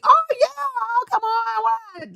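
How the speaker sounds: high, excited imitation of an eager guest. Host imitating the eager guest agreeing to come on, high and excited. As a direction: squeal it like a guest who can't wait to join. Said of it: "The other one was like".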